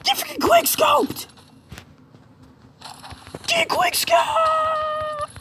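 very high, drawn-out scream; gamer screech. Real, not acted. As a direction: screech it out, stretching the last word. Screams while sniping in game.

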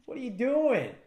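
high, disbelieving what are you doing; exasperated cry. Acted. Fan yelling at a passive fighter, exasperated. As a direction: throw up your hands in disbelief.

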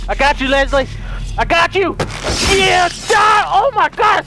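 high, loud battle yell; triumphant shout. Real, not acted. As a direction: scream it as you land the kill. Stabbing zombie in combat frenzy.

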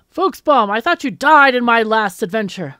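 loud, theatrical hero's challenge; dramatic hero. Acted. Treasure hunter challenging a rival back from the dead, dramatic. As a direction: call out the old enemy with big drama. Plays Ace facing the villain.

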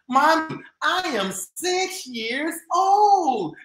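high, slow proud announcement. Acted. Girl tells mom her age, proud. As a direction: state it with pride. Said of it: "Journey stated proudly".

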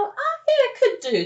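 high offhand yes; mock casual yes, way up; casual acceptance. Casual reply to break question.

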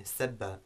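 "sebba" starting on a light S; light, plain S and front vowel in "sebba"; light seen. Shown as a demonstration. Teacher repeating an Arabic example verb, light S in "sebba". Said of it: "which begins with seen".

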